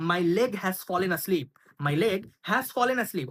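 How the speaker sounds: very slow, clear teacher read; slow model phrase. Shown as a demonstration. Teacher models the English phrase, very slowly. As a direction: spell it out slowly for learners. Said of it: "পায়ে ঝিঝি ধরে যাওয়ার English হচ্ছে".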